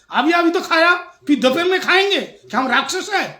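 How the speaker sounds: loud, high-pitched acted outrage; mock indignation. Father rebukes son's lunch question for the guest's ears, mock indignant. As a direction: scold in exaggerated disbelief.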